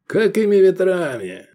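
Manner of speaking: high, effusive question; put-on delight. A narrator's reading. Owner asking his visitor's business, feigned delight. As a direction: ask through a forced beaming smile. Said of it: "залучился деланной улыбкой".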